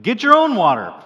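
high, loud playful snap from the wife; mock wife retort. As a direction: throw back the wife's comeback loud and high. Wife's retort in retold banter.

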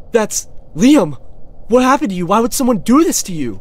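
high, loud horrified cry; yelling out in shock at finding him; shocked shout. Real, not acted. Streamer shouts in shock on finding Liam hurt. Finds his friend hurt.

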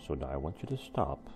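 ordinary delivery, nothing marked

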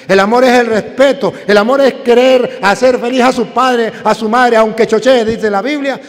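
fervent preaching. Acted. Priest urging children to love their parents, loud preaching. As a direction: preach it to the congregation with force.

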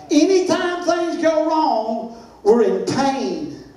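preaching cadence. Acted. Preacher intoning to the congregation, slow chanting cadence.

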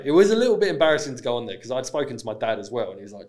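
normal talking voice

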